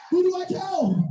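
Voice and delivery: high-pitched wail falling at the end; hammed-up desperate question; excited dilemma. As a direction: cry it out high, comically at a loss.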